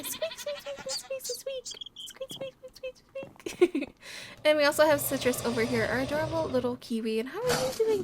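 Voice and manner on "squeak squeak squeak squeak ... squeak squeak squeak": very high, soft squeaks; high breathy squeaking; squeaky fox noises. Retold or imitated. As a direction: imitate tiny squeaky fox calls. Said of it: "cutest little squeaky noises".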